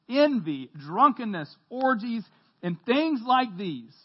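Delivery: loud, emphatic public reading; scripture reading. Acted. Preacher reading verses to the congregation, emphatic. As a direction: read out each item of the list firmly and separately.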